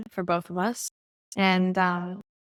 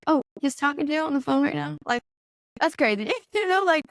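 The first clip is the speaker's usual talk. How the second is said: higher-pitched, amused disbelief; incredulous; 7 semitones higher than usual. Retold or imitated. Passenger doubting the driver hears God, incredulous. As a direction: question it in a raised, disbelieving voice.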